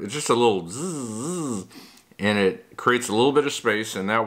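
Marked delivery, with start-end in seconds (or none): 0.7-1.7 buzzing like a tiny sander. Retold or imitated. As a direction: make the drill noise, zzz. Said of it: "with this little sander".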